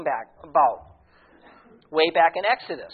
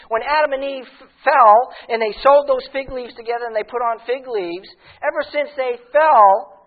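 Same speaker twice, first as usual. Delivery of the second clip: higher, louder, impassioned preaching; fervent preaching; 5 semitones higher, 4 dB louder than usual. Acted. Pastor pleading with the morning congregation, impassioned preaching. Preacher builds to full force.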